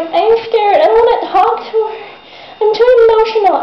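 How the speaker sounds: put-on high, whiny scared-girl voice; mock her as a whimpering baby; mocking whiny Brooke. Vlogger mocking Brooke dealing with divorce, high whiny voice. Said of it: "she's like".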